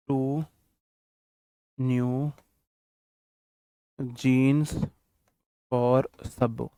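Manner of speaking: very slow, spaced words with long pauses; slow dictation. Shown as a demonstration. Teacher dictating a sentence to correct, slow and spaced. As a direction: read it out word by word for copying. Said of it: "बताना है sentence में कहाँ गलती है".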